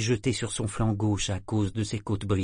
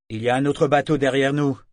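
loud, higher call across the deck; shouted warning; 4 semitones higher, 7 dB louder than usual. Acted. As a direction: shout it out to the captain. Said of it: "cria Saadar".

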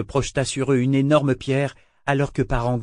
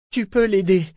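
high, anxious plea; desperate, insistent hope; seeking reassurance; 9 semitones higher, 4 dB louder than usual. A narrator's reading. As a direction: beg to be reassured, voice pitched up. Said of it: "Elle cherchait à être rassurée".

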